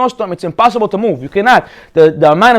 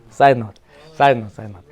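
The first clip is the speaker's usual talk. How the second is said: low, much softer aside; muttered aside; 11 semitones lower, 5 dB quieter than usual. Real, not acted. Preacher mumbling a side remark to himself, much lower and softer. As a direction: throw it away under your breath.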